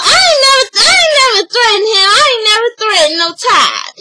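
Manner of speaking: mock furious I ain't never; indignant denial. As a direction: hurl the denial back at her. Mom denies threatening during argument.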